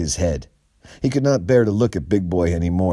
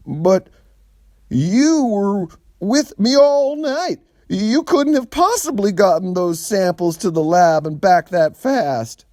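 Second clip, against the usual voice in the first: a dim, eager sidekick protesting; Big Boy voice; 14 semitones higher, 5 dB louder than usual. A narrator's reading.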